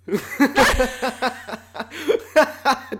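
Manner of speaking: high, loud helpless laugh; loud burst of laughter. Real, not acted. Host cracking up at the joke about dad, loud laugh. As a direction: crack up uncontrollably.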